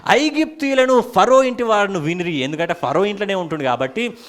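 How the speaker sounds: loud, high-pitched preacher's declamation; full-force preaching. Real, not acted. Preacher telling the congregation how Joseph wept aloud, in full-force preaching. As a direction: proclaim the story at the top of your register.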